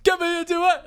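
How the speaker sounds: high, hammed-up title call; mock show-title announcer. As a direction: belt out the show name like a goofy jingle. You wanna do that again?.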